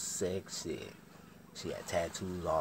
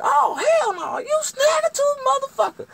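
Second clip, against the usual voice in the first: shrieking, very high outrage; piercing hammed-up tirade; all hell breaking loose; 17 dB louder than usual. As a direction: scream in mock horror and fury. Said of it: "all hell break loose then".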